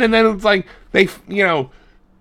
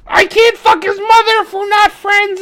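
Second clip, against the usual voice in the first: character voice; 14 semitones higher, 3 dB louder than usual. Retold or imitated. Voicing character in retold movie.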